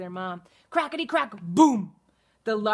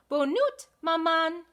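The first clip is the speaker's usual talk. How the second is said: put-on baby duck voice; 7 semitones higher than usual. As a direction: chirp hello like a tiny French duckling. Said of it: "said the baby duck".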